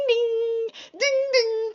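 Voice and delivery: high sing-song ding-ding chime; imitating a doorbell. Complaining about the shop doorbell, high chime imitation.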